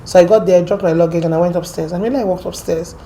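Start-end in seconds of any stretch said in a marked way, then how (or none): none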